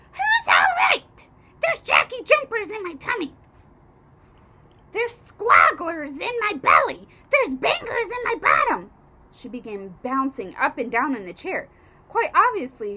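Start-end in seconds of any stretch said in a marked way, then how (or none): 0.1-8.9 high, loud shrieking crone; outraged old hag. A narrator's reading. Grandma rants at the boy, high and loud. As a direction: shriek it like a furious witch.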